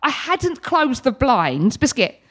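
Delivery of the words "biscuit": ordinary delivery, nothing marked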